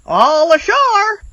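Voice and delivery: high, loud sailors' call; shouted. Acted. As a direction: call it out across the deck. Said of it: "the men shouted".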